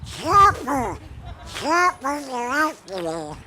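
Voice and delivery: high, squeaky cartoon duck cry; panicked little duck calling for help; Yakky Doodle duck voice. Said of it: "I want you to remember a little duck".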